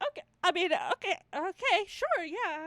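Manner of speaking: high, loud, hesitant going-along; doubtful agreement. Retold or imitated. Friends reacting to him turning her down, doubtful. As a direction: act out friends scrambling to agree.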